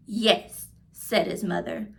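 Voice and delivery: clear, lively read-aloud voice for a class; reading to children. A narrator's reading. Teacher reading a picture book aloud to her class, lively storytelling. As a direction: tell the story warmly to young listeners.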